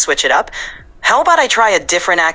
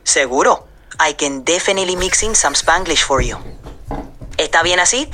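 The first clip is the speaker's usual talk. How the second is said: Puerto Rican accent, sliding between Spanish and English; Puerto Rican Spanglish. Shown as a demonstration. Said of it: "can you just throw in some Spanglish?".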